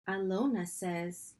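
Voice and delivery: ordinary delivery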